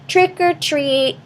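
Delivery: high, slow sing-song call; cried trick-or-treat. Acted. Three kids at Mister Bones' door, high slow sing-song call. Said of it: "cried Queen, Bean, and Raven".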